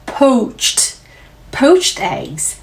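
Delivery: crisp English "poached eggs" with a final t sound; English pronunciation demo. Shown as a demonstration. Teacher modelling "poached eggs" for students. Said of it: "poached eggs라고 표현합니다".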